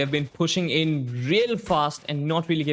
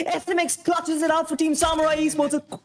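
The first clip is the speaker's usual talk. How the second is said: excited shout; 12 semitones higher than usual. Real, not acted. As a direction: yell the clutch play. Clutch round win called.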